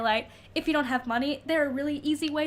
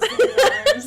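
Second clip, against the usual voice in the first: laughing through the words, a bit louder; 14 semitones higher, 10 dB louder than usual. Real, not acted. Joking to co-host about the composer, laughing. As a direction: giggle it out.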